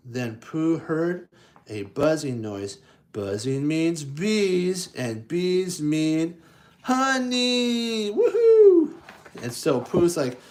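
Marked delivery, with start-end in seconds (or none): 3.1-8.1 slow, rising to a high gleeful honey; building excitement. Acted. 8.1-8.9 very high, loud whoop of joy; cheering for the honey with a very high, loud whoop; loud woohoo. Real, not acted.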